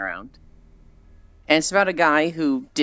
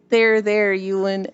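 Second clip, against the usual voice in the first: pat-on-the-back soothing, pitched up; comforting friend; 7 semitones higher, slower than usual. Pretend. Core comforting a crying streamer, high soothing voice. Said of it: "Core gets in Discord with me, and it's just like".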